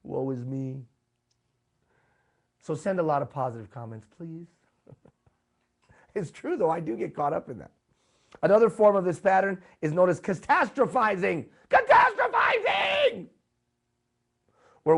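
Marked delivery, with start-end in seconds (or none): 0.0-0.8 slow, mopey self-pity, slightly altered voice; lightly hammed woe-is-me whine. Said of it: "where I start saying". 11.7-13.3 very high, slowed shout of the word; mock-catastrophe cry; dramatic shout. Shown as a demonstration. Names the term, then shouts it.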